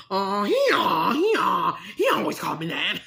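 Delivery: full-volume talking donkey, words stretched into brays; braying jackass. Acted. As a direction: bray the answer like a donkey. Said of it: "You have to say it like that, like a jackass".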